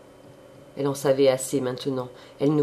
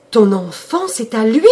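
higher, much louder cry; 8 semitones higher, 11 dB louder than usual. A narrator's reading. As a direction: cry out in shock. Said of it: "poussant un cri".

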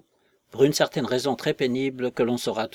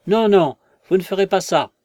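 higher, loud cry of no; cry of protest; 7 semitones higher, 8 dB louder than usual. Acted. The vicar cries out to the invisible man, loud and high. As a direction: shout it out in alarm. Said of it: "puis encore un cri".

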